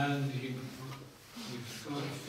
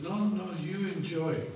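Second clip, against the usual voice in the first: higher and louder, a gently amused refusal; lightly voiced teacher waving off the offer; Prabhupada declining; 6 semitones higher, 6 dB louder than usual. Teacher politely refuses the fisherman's fish, lightly acted. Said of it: "And Prabhupada said".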